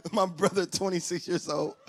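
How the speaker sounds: ordinary delivery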